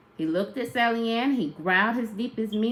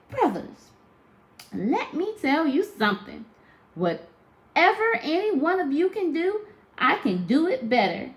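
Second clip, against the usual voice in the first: sassy little sister; 8 semitones higher than usual. Acted. Baby Sally Ann challenging her brothers, high and cocky. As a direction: dare your brothers, chin up. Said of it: "she looked at those brothers of hers and she said".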